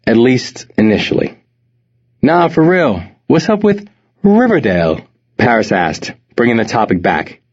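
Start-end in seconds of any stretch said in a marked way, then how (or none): 2.2-5.0 attempted posh British accent, rising at the end; a friend's best stab at proper British. A narrator's reading. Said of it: "made his best attempt at a proper British accent".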